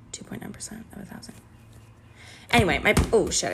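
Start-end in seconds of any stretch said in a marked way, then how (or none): 0.1-1.3 whispered quick sum under her breath; muttered calculation. Real, not acted. Checking the math for herself, whispered fast. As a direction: whisper the numbers to yourself, fast.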